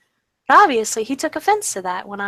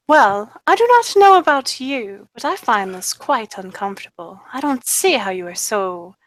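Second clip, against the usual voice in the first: put-on British accent; 4 dB louder than usual. Mimicking his knightly posture, in an English accent. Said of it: "In a British accent, like an English accent".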